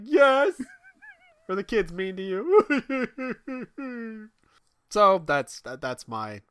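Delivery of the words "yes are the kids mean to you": high, slow, cooing question; mock sweet voice. Dad cooing to his wife about the kids, high and slow. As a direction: coo it like to a pet.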